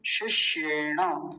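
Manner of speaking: शिष्येण said slowly, each syllable clear; slow sanskrit word. Shown as a demonstration. Teacher slowly pronouncing शिष्येण for students. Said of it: "तालव्य शकार और एक मूर्धन्य शकार".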